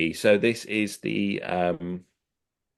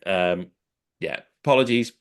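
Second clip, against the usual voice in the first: ordinary talk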